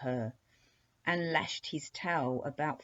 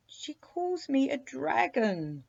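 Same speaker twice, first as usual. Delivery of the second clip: higher, slow, aggrieved serpent groan; a wounded sea monster moaning his complaint; 9 semitones higher, 4 dB louder, slower than usual. Acted. Sea serpent groaning to the princess at being called a dragon. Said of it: "groaned the sea serpent".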